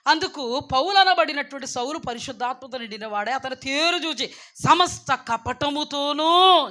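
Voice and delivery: loud, thundering preacher; fiery scripture rebuke. Acted. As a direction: read out Paul's rebuke at full force. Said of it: "చూడు, ఏమని మాట్లాడుతున్నారో ఇక్కడ".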